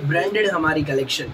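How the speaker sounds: ordinary talk, nothing marked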